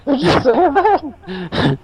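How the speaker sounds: very high, slow, cracked-up question; incredulous squeal. Real, not acted. Player questions the game master's odd phrase, high incredulous squeal. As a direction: squeal it out in stretched disbelief.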